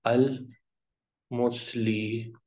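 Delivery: slow teaching pronunciation; slow model word. Shown as a demonstration. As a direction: stretch the word for learners. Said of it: "اونا کی آواز آئے گی".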